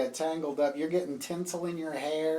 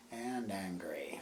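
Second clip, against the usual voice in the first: whispered; 7 semitones lower, 10 dB quieter than usual. Acted. GM describing character mood.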